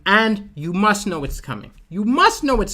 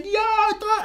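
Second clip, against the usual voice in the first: very high mock wail; hammed-up high crying noise; imitated crying. Crowd of workers in the chaos, imitated crying. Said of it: "all of them crying".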